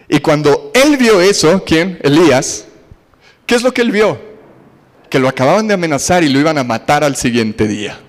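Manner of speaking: ordinary talk, nothing marked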